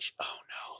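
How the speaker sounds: imitating a dismayed whisper. Woman who touched the cloak realizing he knows, whispered dismay. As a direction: whisper it as a private, retold 'uh-oh'. Woman dreads being found out.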